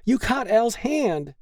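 very high-pitched distressed cry; alarmed yell. A narrator's reading. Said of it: "she yelled".

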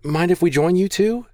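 high, squeaky question; nervous squeak. A narrator's reading. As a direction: squeak it out while shoring up your nerve. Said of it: "Maggie squeaked".

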